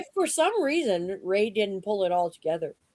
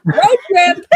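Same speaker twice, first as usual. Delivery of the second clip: excited; 10 semitones higher, 9 dB louder than usual. Real, not acted. Cheering the planned road trip.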